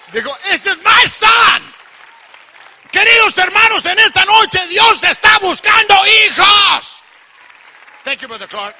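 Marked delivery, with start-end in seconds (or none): 0.5-1.7 high, slow, loud shout; proud proclamation. Acted. Father claiming his son before everyone, proud shouted proclamation. 2.9-7.0 loud, high revival shout; full-force preaching. Real, not acted. Preacher to congregation about God seeking sons, shouted preaching.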